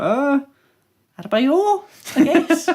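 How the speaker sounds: high, half-laughing grudging pick; reluctant shrug. Retold or imitated. Fans grudgingly picking Adebayor as striker, high half-laughing shrug.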